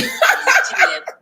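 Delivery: laughing. Real, not acted. Laughing disbelief at lover leaving.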